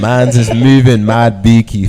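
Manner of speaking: slow, loud and laughing, hamming up London slang; loud laughing slang line. Acted. Friend shows off London slang, slow, loud and laughing.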